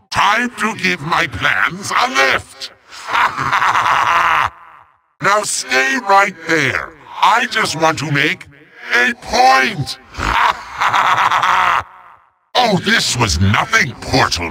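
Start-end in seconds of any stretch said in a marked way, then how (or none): 3.1-4.5 big staccato villain laugh; evil cackle. Acted. Villain gloating over his foe's doom, evil cackle. 10.2-11.8 big staccato villain laugh; evil cackle. Acted. Villain gloating over his foe's doom, evil cackle.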